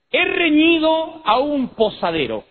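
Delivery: high, slow, declaiming teacher; old-time schoolteacher. Retold or imitated. Teacher announcing a spelling rule to pupils, mimicked schoolmarm. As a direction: recite it grandly like a mimicked schoolmistress. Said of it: "Un día llegó la maestra, dijo".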